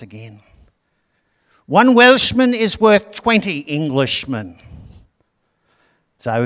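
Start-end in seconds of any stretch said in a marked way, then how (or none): 1.6-4.6 high, loud, slow Welsh boast; bad Welsh accent, louder. The Welshman taunts the English captain, a loud boastful Welsh accent. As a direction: call it out of the fog in a poor Welsh accent. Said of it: "I can't do a Welsh accent".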